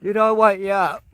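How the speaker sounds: the same question said out loud and bright. Real, not acted. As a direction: call it out to rouse him.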